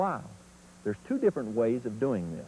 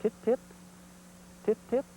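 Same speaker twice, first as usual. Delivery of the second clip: "Tit, tit" piped very high and short; "tit" each a tiny high rising chirp; 10 semitones higher than usual. Shown as a demonstration. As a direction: chirp "tit" like a young turkey's pip. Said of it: "And I'm saying".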